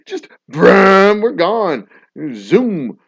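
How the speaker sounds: loud revving brum and zoom; engine noises. Retold or imitated. Jeep flying off the road, loud engine noises. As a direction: rev it like a Jeep taking off. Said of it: "jump off the road and brrr".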